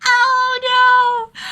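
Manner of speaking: hammed-up shocked whine; Miyu's wail. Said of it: "Miyu would be like".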